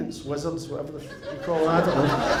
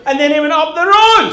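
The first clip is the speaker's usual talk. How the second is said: high, loud sermon climax; triumphant shout; 11 semitones higher, 13 dB louder than usual. Retold or imitated. Guest preaching E T to the bishop, triumphant shout. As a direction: belt out the clinching point.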